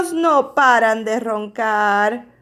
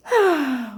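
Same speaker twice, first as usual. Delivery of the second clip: mock bear sigh. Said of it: "Oso suspira".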